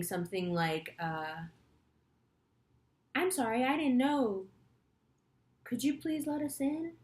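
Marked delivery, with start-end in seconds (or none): 3.1-7.0 higher, sugary mock-apologetic plea; forced humble apology. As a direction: play the meek pleading customer you hate being. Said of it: "gonna make me sick to have to do it".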